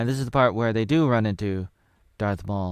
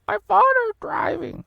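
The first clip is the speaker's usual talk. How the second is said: squeaky high voice; 6 dB louder than usual. Acted. Squeaky character voice.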